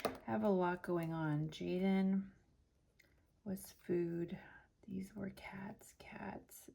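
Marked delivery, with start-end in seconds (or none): 3.4-6.7 whispering. Real, not acted. Whispering while sorting receipts.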